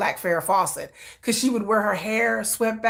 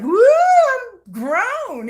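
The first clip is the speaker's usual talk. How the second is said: high, loud and drawn-out woo; excited whoop; 6 dB louder, much slower than usual. Retold or imitated. Teen telling grandmother she's grown, stretched high whoop. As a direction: crow it like a freed teenager.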